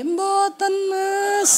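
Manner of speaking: slow, sweet exaggerated Javanese wife voice; put-on polite Javanese. Wife waking husband in mock-polite Javanese. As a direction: drawl it out like a dainty Javanese wife. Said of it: "aku koyo wong Jawa".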